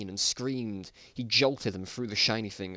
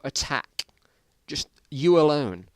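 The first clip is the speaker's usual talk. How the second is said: mock shout; 4 semitones higher, 7 dB louder, slower than usual. Shown as a demonstration. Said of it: "just shout".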